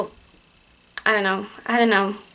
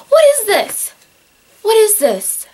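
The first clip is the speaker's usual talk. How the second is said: high-pitched, louder incredulous outburst; real disbelief; 9 semitones higher, 5 dB louder than usual. Real, not acted. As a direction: exclaim it high, baffled by what you see. Baffled by sudden weather change.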